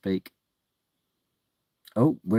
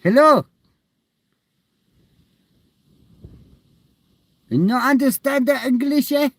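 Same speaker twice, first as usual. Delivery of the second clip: high loud broken English in a mock foreign accent; loud comic foreign-accent call; 7 dB louder than usual. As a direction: tease him in a put-on foreign accent.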